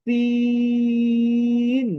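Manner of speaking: সিন with a long hissed s and high, loud held ee; pressure-cooker hiss. Shown as a demonstration. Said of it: "pressure কুকারে একটি sound হয়". (প্রেসার কুকারের মতো শিস দিয়ে লম্বা সিন)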